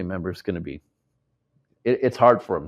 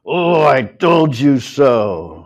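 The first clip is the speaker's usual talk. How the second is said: slow, loud, gloating I told you so; smug told-you-so; 5 semitones higher, 9 dB louder, much slower than usual. Retold or imitated. To a friend coming back, a loud gloating I told you so. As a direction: rub it in, drawn out and loud. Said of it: "instead of saying".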